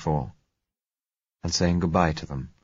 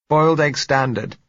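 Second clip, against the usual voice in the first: muffled voice; 9 semitones higher, 6 dB louder than usual. A narrator's reading. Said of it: "said her muffled voice".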